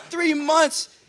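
high, whining mock wail; hammed-up tearful complaint; mock crying. Fiancé begging his future mother-in-law, whining in mock tears. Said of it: "I'm on the phone crying".

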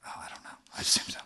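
very soft whispered reply; whispering. Real, not acted. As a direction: whisper back conspiratorially.

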